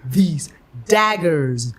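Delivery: slow, separated "these daggers"; practising diction. Retold or imitated. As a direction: rehearse "these daggers" with stretched vowels. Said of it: "the whole time I was like".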